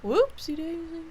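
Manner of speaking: high, cutesy repeat of Whoopsie daisy; silly high whoopsie. Pretend.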